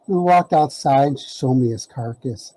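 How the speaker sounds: ordinary talk, nothing marked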